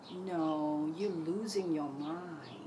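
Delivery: slow, low taunt; lightly voiced sinister mind. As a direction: drag it out to unsettle. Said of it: "answer my mind".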